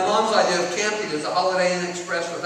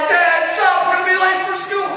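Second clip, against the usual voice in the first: put-on much higher, louder kid's protest; kids protesting; 11 semitones higher, 6 dB louder than usual. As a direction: whine like a laughing kid in the back seat. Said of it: "They're like".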